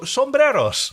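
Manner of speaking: sombreros rolled with a Spanish r. Acted. Joking Spanish pronunciation.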